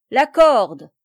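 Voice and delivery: loud, gleeful shout of the verdict; soldiers' loud cry; shouted answer. A narrator's reading. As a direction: bark it out together like a rough crowd. Soldiers answer about the hanging.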